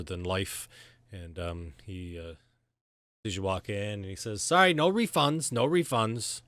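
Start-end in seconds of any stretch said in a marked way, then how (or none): 4.4-6.4 higher, louder shopkeeper bark; pushy merchant refusing refunds; gruff shopkeeper. Acted. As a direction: shut the customer down fast.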